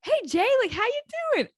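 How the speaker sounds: high singsong hey, how you doing; imitating assistant coach. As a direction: chirp it like a cheery coach checking in. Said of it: "she comes up to me and she's like".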